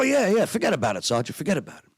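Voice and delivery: fast New York "forget about it"; lightly played New York talk; New York accent. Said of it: "You really talk like that?".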